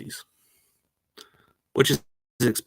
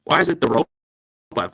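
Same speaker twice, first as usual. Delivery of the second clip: slow, glitchy robot voice; choppy robot voice over a bad line; slower than usual. Real, not acted. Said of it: "like you said".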